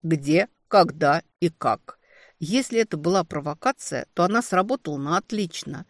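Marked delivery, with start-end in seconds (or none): 0.0-2.0 slow, measured, every word apart; each word hammered. Acted. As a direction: spell out each word coldly. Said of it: "отчеканил он".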